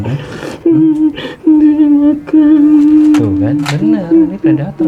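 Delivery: put-on high, small girl spirit; ghost child voice. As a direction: speak as the little ghost.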